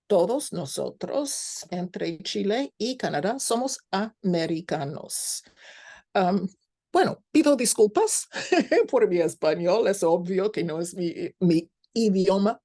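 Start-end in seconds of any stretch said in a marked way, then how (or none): none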